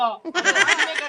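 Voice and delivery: a village elder chuckling while threatening the brothers; laughing mock threat. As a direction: laugh it out as a half-joking warning.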